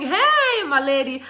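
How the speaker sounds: high, loud delighted hey; light up at seeing her; excited greeting. Real, not acted. Greeting a viewer on his live stream, high and loud.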